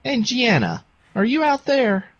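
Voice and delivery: high-pitched singling-out call. Acted. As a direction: call for someone out of sight, pitched well up. Calling someone outside the room.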